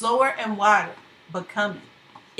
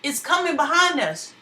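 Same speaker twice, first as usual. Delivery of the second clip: raised, alarmed warning; frightened cry; 6 semitones higher, 4 dB louder than usual. Acted. Fugitives warning each other of the flood, alarmed. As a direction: cry out the danger behind you.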